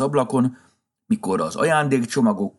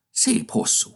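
ordinary delivery, nothing marked

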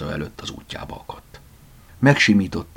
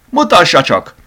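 put-on high, loud call from an older gentleman; raised, commanding cry; shouting; 11 semitones higher, 10 dB louder than usual. A narrator's reading. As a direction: call out eagerly to see it. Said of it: "kiáltotta az idősebb úr".

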